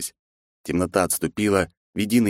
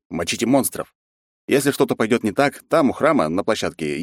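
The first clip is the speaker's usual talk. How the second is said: ordinary delivery, nothing marked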